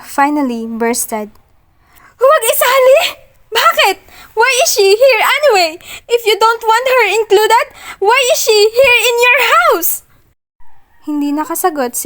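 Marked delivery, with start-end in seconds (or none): 2.2-4.0 "Huwag isali?" yelled much higher and louder; bursting shout. Acted. Heroine finally losing control at Achilles, bursting shout. 4.3-10.0 high, loud, furious demand; furious yell. Acted. Heroine demanding why Alana is in his house, furious yell. Balloon finally bursts.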